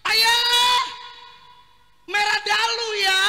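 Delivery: high, loud startled cry; wife shocked by the red marks on his back; shouting wife. Acted. Wife scraping husband's back.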